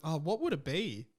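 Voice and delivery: ordinary talk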